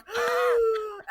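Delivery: loud, high panicked gasp, acted out; mock gasp of sudden panic; losing it. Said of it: "you just see me lose it".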